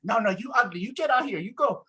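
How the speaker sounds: high-pitched imitation of an angry shopkeeper; retold angry yelling. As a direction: mimic her yelling a customer out of the store. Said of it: "She starts just yelling at me".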